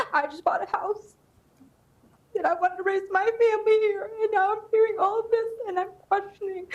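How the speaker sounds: fighting back tears as she pleads for her family home; tearful. Real, not acted. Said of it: "I'm starting to get emotional".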